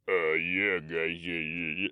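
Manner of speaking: low mumbling Stallone-ish drone; lightly voiced Stallone mumble; same old voice. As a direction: grunt it out like a lazy tough guy. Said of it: "That's Sylvester Stallone".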